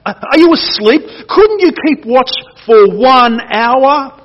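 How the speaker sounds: Jesus rebuking Peter loudly for sleeping; exasperated rebuke. Acted. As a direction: wake them up with a frustrated cry.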